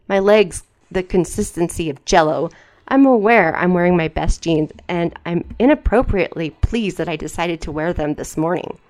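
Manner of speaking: ordinary talk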